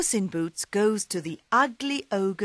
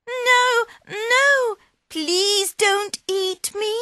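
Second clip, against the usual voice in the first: very high, louder cry of fear; frightened cat; 7 dB louder, slower than usual. Acted. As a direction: squeal it out in panic. Puss frightened by ogre.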